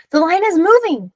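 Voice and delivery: put-on high, loud, thrilled kid; a boy yelling that a crab is biting; excited shout. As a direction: call out the catch with glee. Boy sees crab bite.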